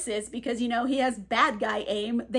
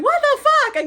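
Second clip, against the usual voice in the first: Viv's shrieked what the fuck, pitched way up and loud; shocked yell; 9 dB louder than usual. Retold or imitated. Viv, stunned by James running off naked, yelling in disbelief. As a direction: blurt it out high and loud in disbelief. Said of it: "Viv is just going".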